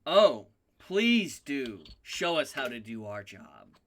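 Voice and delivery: slow drawn-out start, dropping low; mock-grateful, stretched "please do"; sarcastic welcome. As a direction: feign gratitude to a cocky newcomer. GM voices sarcastic reply to newcomer.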